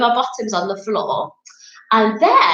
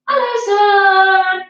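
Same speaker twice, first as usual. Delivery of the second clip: slow, sing-song and a bit louder; louder hello; 7 semitones higher, 3 dB louder, slower than usual. Shown as a demonstration. Teacher calls to the sun louder for the kids, sing-song. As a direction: greet the sun brightly and louder. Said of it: "can we say it a bit louder".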